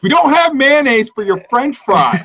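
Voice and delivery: high-pitched comic exasperation; hammed-up outburst, pitched high; mock outraged rant. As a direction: rant at tourists in playful indignation. Mock rant at Canadian tourists.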